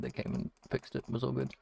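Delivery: usual speaking voice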